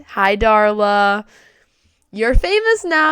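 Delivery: loud, drawn-out greeting to a friend; playful shout-out. Real, not acted. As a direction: call out slowly, stretching the words loud. Shout-out to her coach.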